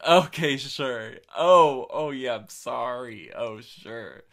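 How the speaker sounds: loud, drawn-out openers, easing off; condescending fake kindness. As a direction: act overly agreeable with a big fake smile. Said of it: "they know it's condescending".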